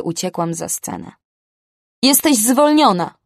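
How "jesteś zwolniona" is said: yelled, higher and louder; angry yell. Acted. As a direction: scream the firing at her. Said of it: "wrzasnęła na mnie Susan".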